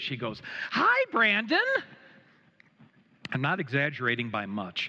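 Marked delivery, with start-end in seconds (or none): 0.7-1.6 high, loud, bubbly squeal of a greeting; light up and chirp his name; imitating a scattered, perky churchgoer. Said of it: "says in the way that only she could say it".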